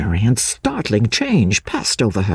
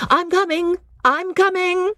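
very high woman's call, no real volume; calling from afar. A narrator's reading. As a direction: call out reassuringly as if from behind trees. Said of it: "cried the voice from behind the clump of dwarf trees".

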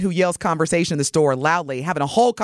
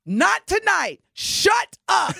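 high, forceful comic outburst; exasperated yell, hitting each word; retold angry yell; 12 semitones higher, much slower than usual. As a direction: snap at a noisy neighbor, hammed up. Retells yelling at the woman.

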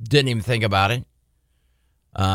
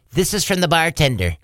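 waitress voice; 7 semitones higher than usual. Retold or imitated. Waitress quoted serving drink.

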